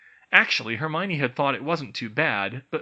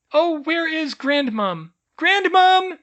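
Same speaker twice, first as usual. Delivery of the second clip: calling out; 11 dB louder, slower than usual. A narrator's reading. Elf calling out for grandmother.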